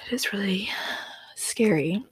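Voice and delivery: hushed, breathy whisper. Real, not acted. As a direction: whisper it like a secret. Whispering about a spooky story.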